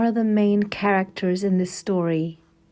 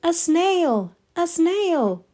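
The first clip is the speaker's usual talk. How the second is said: startled cry; 8 semitones higher, 4 dB louder, slower than usual. Acted. Teacher spots snail in class.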